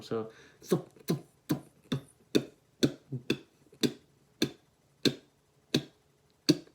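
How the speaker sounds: dial the vowel out of "thump"; thump fading. Shown as a demonstration. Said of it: "I'm turning the dial down".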